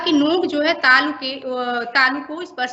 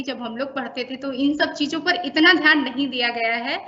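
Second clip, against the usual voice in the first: ordinary talk, nothing marked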